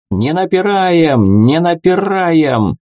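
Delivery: high, drawn-out crowd-control shout; long, stretched call; bellowed order. A narrator's reading. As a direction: hold back a pushing crowd. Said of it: "заорал Слав".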